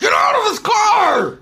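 high, loud mock shout at the passenger; yelling along. Real, not acted. Podcaster yelling along with the angry driver, shouting. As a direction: scream it at the woman in the car.

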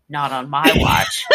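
a low, laughing mock of a determined Nancy; low voice, half laughing, playing the stubborn heroine; Nancy's defiance. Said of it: "And Nancy's like".

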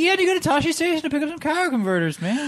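high-pitched mocking whine; hammed-up high complaint; whiny mock Luke. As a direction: whine like a petulant teen.

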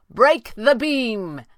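loud, drawn-out high call, falling; called command. A narrator's reading. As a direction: shout the order up, stretching each word. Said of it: "he called".

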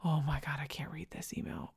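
dread-filled whisper, breath only; panicked whisper. Retold or imitated. Dreading a client's email, panicked whisper. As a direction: whisper it like you can't bear to look. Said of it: "and I would be like".

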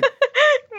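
high delighted giggle. Acted. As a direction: burst into a giggle. She laughs at his pitch.

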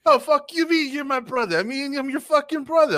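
high hammed-up Brooklyn accent; goofy Brooklyn squabble; mock Brooklyn brothers. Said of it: "Liquid has the same accent".

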